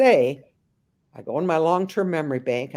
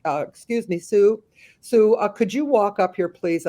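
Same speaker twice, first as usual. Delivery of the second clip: raised, higher calling voice; holler across the room; 6 semitones higher, 4 dB louder than usual. Retold or imitated. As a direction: call her from the front of the room. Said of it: "I holler".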